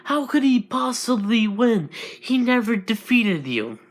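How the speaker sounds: the father objecting, pitched well up; father's voice. Acted. Father challenging the monster, high and indignant. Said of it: "Inko's father saying".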